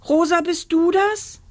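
high, thin searching call; thin distant cry. A narrator's reading. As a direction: call up anxiously from below. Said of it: "schrie eine dünne Stimme von unten".

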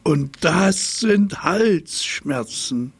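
high, slow, grand pronouncement; proud giraffe. A narrator's reading. Giraffe proudly declaring her sore throat, slow and high. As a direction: draw it out like a diva.

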